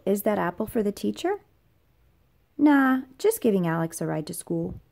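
ordinary delivery, nothing marked

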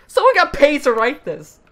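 high loud incredulous cry; exasperated outburst. Real, not acted. Host ranting about the article, high loud disbelief. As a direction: shout it in disbelief.

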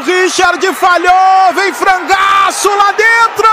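high, slowed shout at the blunder; excited call. Real, not acted. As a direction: shout out the keeper's howler. Goalkeeper blunder called.

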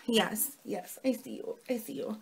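very soft murmur to a cat; soft pet talk. Real, not acted. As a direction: hush it down, talking to the cat. Talking softly to her cat.

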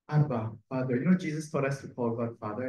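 usual speaking voice

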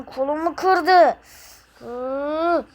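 ordinary delivery

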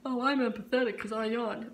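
mocking voice. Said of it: "Maybe that's just an excuse".

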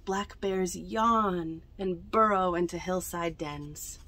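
usual speaking voice

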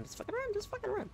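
high panicked plea; squeaky desperate urging; being chased. Real, not acted.

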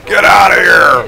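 loud, high, drawn-out shout; gleeful yell. Real, not acted. Player yells at the cop right there, drawn-out shout. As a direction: holler it slowly at full voice.

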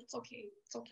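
soft, soothing reassurance; calm her down gently. Retold or imitated. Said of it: "she's like".

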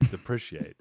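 "depreciate" with a short i, as "de-PRISH-iate"; mispronounced. Shown as a demonstration. Host retrying the word for the panel, mispronounced. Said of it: "my dum-dum coming out".

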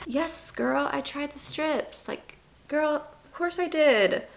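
high, soft, slow weary sass; deadpan exasperation to a pushy friend. Retold or imitated. Answering a friend who pushes ovulation strips, weary sass. Her tired reply to advice.

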